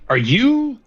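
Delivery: high, loud are you; shocked question. Acted. Sergeant recognising the intruder, shocked shout. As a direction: blurt it out in alarm. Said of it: "face completely changes".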